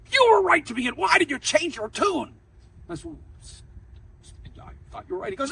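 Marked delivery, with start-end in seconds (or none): 0.1-2.3 loud, higher, demanding challenge; stern professor. Retold or imitated. Professor demands to know why the student changed his answer, stern. 3.0-5.3 soft, breathy, rushed stammering excuse; scared stammer. Retold or imitated. Scared law student stammering an answer to the professor, timid.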